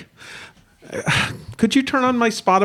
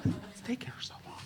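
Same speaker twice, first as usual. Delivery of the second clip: hushed impatient whisper; 24 dB quieter, faster than usual. Real, not acted. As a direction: whisper it under your breath. Whispered aside.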